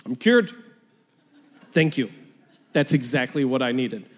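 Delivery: deadpan sarcastic thanks; mock gratitude. Preacher acting someone told to stop being mad, mock-grateful. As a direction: play suddenly cured, dryly. Mock reply to useless advice.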